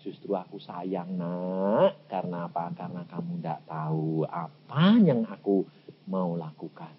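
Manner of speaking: hushed, loving reassurance in God's voice; God answering tenderly. Acted. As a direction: answer a child gently, pitched well down. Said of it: "Tuhan jawabnya apa?".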